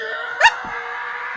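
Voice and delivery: high shrieking laugh of fright; startled scream. Real, not acted. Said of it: "Oh, that scared me".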